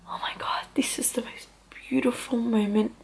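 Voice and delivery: breathless hushed awe, retold; awed whisper. Mother with newborn on her chest, awed whisper. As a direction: whisper it in wonder. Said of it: "I literally was just like".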